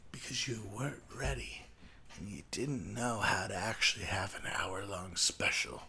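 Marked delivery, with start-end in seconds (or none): none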